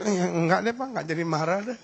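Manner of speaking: lightly acted meek retreat, low and softening; backing-down wife. Wife thinks twice and calms down before husband, lightly meek. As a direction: back off meekly, pitched a little down. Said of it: "Dia kan jadi mikir".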